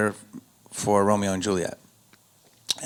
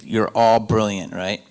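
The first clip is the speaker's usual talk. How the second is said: ordinary talk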